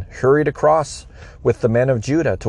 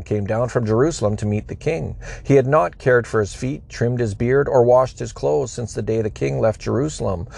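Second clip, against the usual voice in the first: plain reading voice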